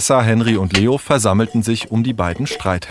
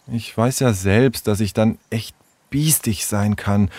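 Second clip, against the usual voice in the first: contrite; 3 semitones higher than usual. Acted. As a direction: admit your fault, pausing to find words. Sarah's hesitant apology to Tom. (reumütig und stockend)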